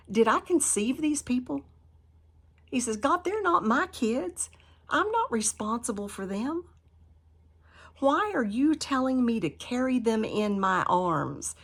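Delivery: high, loud, pleading protest to God; exasperated Moses. Acted. Moses protesting to God about the people, high and loud. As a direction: argue like a fed-up Moses refusing the job. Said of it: "I love Moses's argument".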